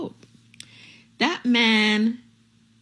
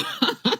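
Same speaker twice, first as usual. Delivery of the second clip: high laugh; 14 semitones higher than usual. Real, not acted. Laughing at viewers.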